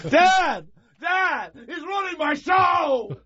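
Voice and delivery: high, slow whiny shout for dad; kid calling for dad. Retold or imitated. Boy tattling to dad about brother, high whiny shout. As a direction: wail for dad like a tattling child.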